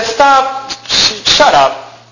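high, slow brush-off of bad news; disbelieving brush-off. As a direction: push the news away, half laughing in disbelief. Telling friend to stop, disbelief.

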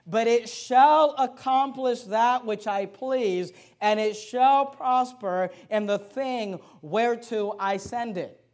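very slow, emphatic verse recital; solemn scripture reading. Acted. Preacher reads Isaiah to the congregation, slow and declamatory. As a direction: weigh every word, pausing between phrases.

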